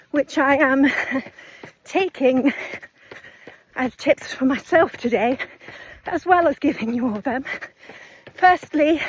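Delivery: out of breath, talking mid-run; panting between short phrases; while running. Real, not acted. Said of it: "my breathing is just all over the place".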